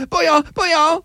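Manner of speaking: high mock Chinese accent.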